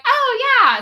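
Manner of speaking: high, loud retold "oh"; mock-polite enthusiasm. Answering the man about her shirt, mock enthusiasm. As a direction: imitate your own bubbly reply.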